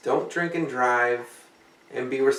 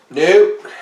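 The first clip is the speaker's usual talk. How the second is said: loud, higher curt nope; ghost answer; 6 semitones higher, 13 dB louder than usual. Pretend. Ouija ghost answering questions.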